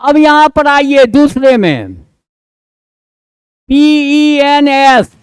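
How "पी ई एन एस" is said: slow letter-by-letter spelling; spelled out. Shown as a demonstration. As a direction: spell each letter out slowly. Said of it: "pens माने क्या?". (धीरे-धीरे अक्षर बोलकर)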